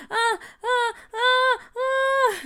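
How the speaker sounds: string of high, loud yelps; pained yelp. A narrator's reading. Nathaniel's braces hit the seat, pained high yelps. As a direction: yelp in sudden pain, over and over. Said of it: "Nathaniel yelped".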